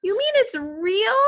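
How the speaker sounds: very high, loud shocked scream; mother screaming at the news. A narrator's reading. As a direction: shriek it in disbelief. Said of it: "his mother screamed".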